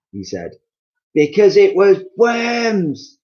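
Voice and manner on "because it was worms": slow, high, loud gloating; triumphant cry. Acted. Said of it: "cried Missus Twit".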